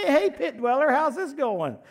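high, loud, sing-song taunting greeting; mocking taunt. Preacher taunting the devil in the pit, high and loud mockery. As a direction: tease him like a bully calling down into a pit. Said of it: "I can't wait to mock him, to ridicule him".

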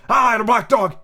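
put-on a grim old sailor agreeing; rough old pirate voice; old captain confirming.

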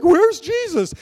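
high, loud frantic call of scared disciples; panicked cry. Retold or imitated. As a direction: cry out in a storm panic, searching for him. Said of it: "the disciples are freaking out and they're like".

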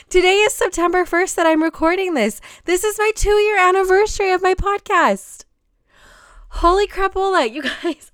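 high, loud burst of sudden realization; loud, high-pitched amazement with breathless laughs; real excited shock. Real, not acted. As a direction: blurt out thrilling news you have only just noticed. Sudden realization of podcast anniversary.

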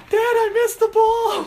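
put-on high, loud, wailing little-girl voice; crying daughter. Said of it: "she was crying".

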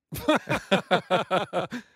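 high, loud belly laugh; loud burst of laughter. Real, not acted. Laughing at the listener's question.

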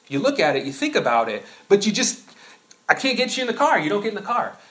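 usual speaking voice

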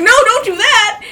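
alarmed cry. Retold or imitated. Begging the hawk not to eat her hand, loud and high. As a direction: yelp at the hawk to stop. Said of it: "And so I was like".

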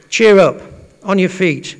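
loud, high rallying call, retold. Crowd calls to the blind man.

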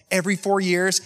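eager nod of a planner saying "Every four years"; lightly voiced planner approving "Every four years"; mock-enthused planner. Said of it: "planners are just like".

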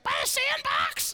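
put-on slow, high, shouted child voice; loud toddler daughter. Daughter guessing where the rabbit is, loud toddler shout. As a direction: bellow it slowly in a squeaky toddler pitch. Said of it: "of course, she's loud".

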